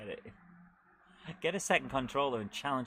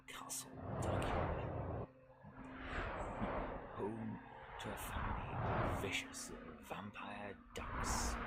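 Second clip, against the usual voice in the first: softer spooky intro voice; spooky narration; 2 semitones lower, 4 dB quieter than usual. Acted. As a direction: whisper it like a horror trailer. Quotes a cartoon intro spookily.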